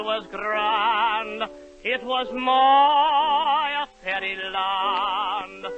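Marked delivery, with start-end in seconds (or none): none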